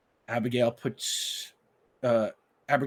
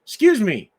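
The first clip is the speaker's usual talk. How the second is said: much higher, louder, halting stranger's voice; polite stranger; 11 semitones higher, 12 dB louder than usual. Acted. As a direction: stop her with a careful excuse me. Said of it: "And he says".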